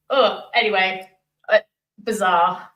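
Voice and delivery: normal talking voice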